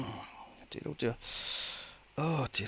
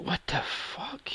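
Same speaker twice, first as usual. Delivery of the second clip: incredulous; 7 semitones higher, 7 dB quieter than usual. Retold or imitated. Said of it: "I went".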